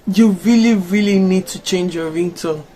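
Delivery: ordinary delivery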